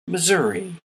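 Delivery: ordinary talk, nothing marked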